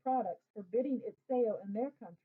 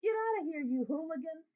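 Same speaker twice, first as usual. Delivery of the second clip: put-on vendor shooing kids; 6 semitones higher than usual. A narrator's reading. Vendor shoos boys away.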